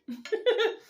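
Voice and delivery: bright high laugh; a quick burst of laughter. Real, not acted. Laugh after quote.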